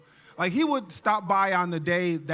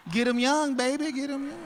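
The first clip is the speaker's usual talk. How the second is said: lightly voiced pimp, pitched up; pimp dad; 5 semitones higher than usual. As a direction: toss it out like a hustling pimp. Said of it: "Pimp, Dad".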